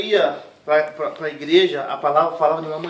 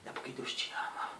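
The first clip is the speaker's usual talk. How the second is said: soft reassurance; 17 dB quieter than usual. Retold or imitated. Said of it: "Ela falou".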